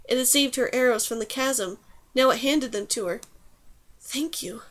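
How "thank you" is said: a soft, near-whispered thank-you; hushed thanks. A narrator's reading. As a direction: say it much more softly, as if whispering. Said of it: "she whispered".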